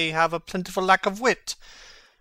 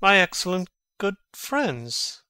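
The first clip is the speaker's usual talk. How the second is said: ordinary talk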